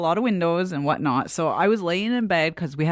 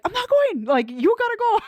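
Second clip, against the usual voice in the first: high-pitched mock yelling; retold yelling match. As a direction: replay a hollered refusal in a high voice. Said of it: "yelling, like".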